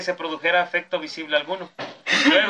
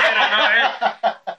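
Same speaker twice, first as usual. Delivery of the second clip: slow, louder, laughing through it; laughing echo; 6 semitones higher, 6 dB louder, much slower than usual. Real, not acted. Echoing the friend's joke about him, laughing slowly. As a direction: stretch the words out while cracking up.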